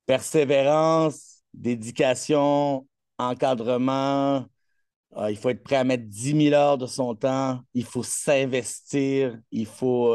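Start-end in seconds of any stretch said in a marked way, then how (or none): 0.1-4.5 very slow, word by word; weighty listing. Real, not acted. Coach answering how to win a medal, slow weighty list. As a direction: draw out each word as a key principle.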